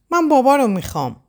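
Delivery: high-pitched mock-crying child; tearful tantrum. A narrator's reading. Little girl on the floor demanding her father, tearful tantrum. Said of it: "گریه کنان دمر روی زمین افتاد".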